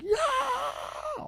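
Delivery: very high, drawn-out scream of yeah. Shown as a demonstration. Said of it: "the high scream, where you're just like".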